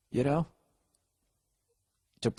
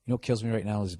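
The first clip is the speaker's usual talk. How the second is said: ordinary delivery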